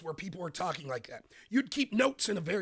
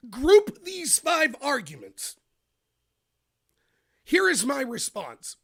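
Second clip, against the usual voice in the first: loud, slow and deliberate; debate grouping; 5 semitones higher, 5 dB louder, slower than usual. Shown as a demonstration. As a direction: announce it like a debater. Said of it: "Sometimes what you do".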